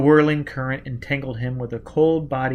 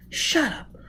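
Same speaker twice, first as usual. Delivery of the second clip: put-on high, tense girl's voice; frightened young woman; 13 semitones higher, 3 dB quieter than usual. A narrator's reading. Gisbella protesting to Foyle that there is no air. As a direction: pitch up, sound scared.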